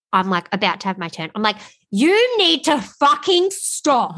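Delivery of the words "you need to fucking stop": slow, high-pitched furious demand; angry outburst. Retold or imitated. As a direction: retell losing it, dragging out every word. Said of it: "like, I get angry".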